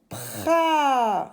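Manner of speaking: demonstrating pra as one long syllable; one-breath syllable. Shown as a demonstration. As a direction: push pra out in one sustained sound. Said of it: "c'est une syllabe".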